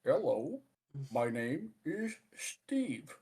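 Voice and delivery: put-on slow Indian telemarketer faking an American accent; mock a halting attempt at American accent; stretched-out, unconvincing American accent on an Indian caller; badly faked American accent. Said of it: "try to put on the American accent".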